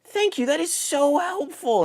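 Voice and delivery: high, slow praising a pet; mock grateful praise. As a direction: talk to it like a good dog. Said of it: "And you go".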